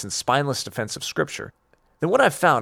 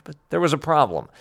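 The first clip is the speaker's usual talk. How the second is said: normal talking voice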